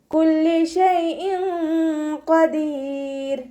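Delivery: slow, drawn-out melodic tajweed chant; Quran recitation. Shown as a demonstration. Teacher models melodic Quran recitation for students. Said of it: "ലാമിന് ശ്രദ്ധ വന്നു".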